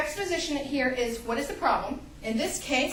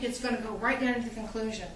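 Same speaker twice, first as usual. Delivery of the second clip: ordinary delivery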